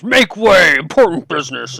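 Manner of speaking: loud, high-pitched Minotaur boom. Acted. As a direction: bellow it like a huge Minotaur. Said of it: "as the seven and a half foot Minotaur says".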